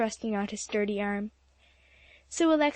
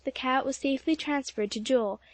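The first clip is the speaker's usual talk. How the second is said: plain reading voice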